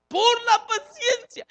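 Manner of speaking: crying out. Acted. Preacher repeats the key phrase.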